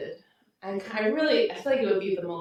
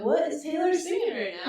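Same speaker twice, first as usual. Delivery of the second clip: higher-pitched eager question; excited fan; 8 semitones higher than usual. Pretend. Fan starting the day on Taylor's livestream, excited.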